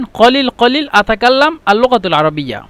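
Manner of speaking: usual speaking voice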